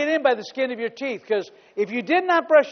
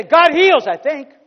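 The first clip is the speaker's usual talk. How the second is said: mocking doubter; 7 dB louder than usual. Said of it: "I don't say".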